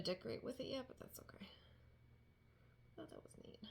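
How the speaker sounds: unvoiced, hushed aside; whispering. Real, not acted. Musing to the viewers in a whisper. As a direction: drop to a whisper. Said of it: "trails into a whisper".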